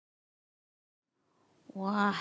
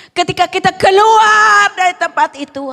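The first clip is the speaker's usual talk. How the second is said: emphatic preaching shout; 9 semitones higher, 18 dB louder than usual. Real, not acted. Preacher asking believers about life outside church, ringing shout. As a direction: belt it out to the whole congregation. Preacher repeats his challenge.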